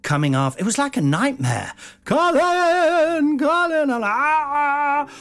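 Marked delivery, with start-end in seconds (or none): 2.0-3.9 high, loud imitation of Mum yelling; mum calling. Mum chasing her son down dark corridors, high and loud. 3.9-5.1 mock scream. Fleeing in panic.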